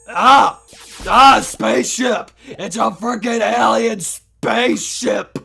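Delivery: loud, alarmed cartoon yelling; panicked outburst. Player meets an alien spaceship, panicked shouting. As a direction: yell in alarm, stretching the complaint out.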